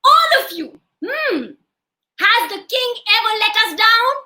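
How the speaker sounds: high, loud address to a crowd; acted indignant demand; challenging wife. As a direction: challenge them like a scolding woman. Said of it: "And then she said".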